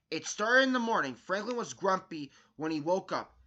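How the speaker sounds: usual speaking voice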